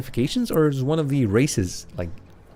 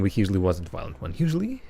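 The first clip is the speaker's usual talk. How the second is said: ordinary talk, nothing marked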